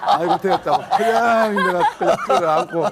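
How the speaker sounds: high, loud, slowing laughter; joyful laughing. Real, not acted. Pastor laughing for joy as his wife wakes.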